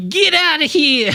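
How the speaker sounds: put-on high, slow crusty old-timer; cowboy sidekick; old prospector voice. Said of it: "Say it in the old prospector voice".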